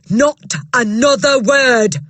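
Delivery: loud, slow, final shout. Acted. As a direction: slam the door on the talk. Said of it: "shouted Miss Trunchbull".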